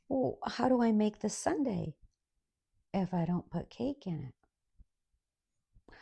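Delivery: slow, puzzled server question; confused server. Retold or imitated. Server Debbie asking the customer, slow and puzzled. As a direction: drawl the question like it makes no sense.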